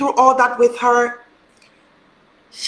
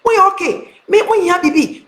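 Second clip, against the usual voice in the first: higher, tender reassurance; caring friend on the phone; 8 semitones higher than usual. Retold or imitated. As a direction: soothe her like a sister, pitched up. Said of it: "Missus Mensah picked up the phone".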